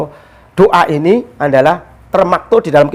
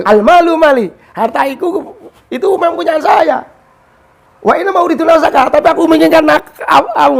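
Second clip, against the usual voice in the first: high, cocky robber's voice; swaggering robber; 9 semitones higher than usual. Retold or imitated. Robber claiming the merchant's goods and life, high mock swagger. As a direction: act the swaggering thief, pitched well up. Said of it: "pencurinya kan ini agak ada petatilan".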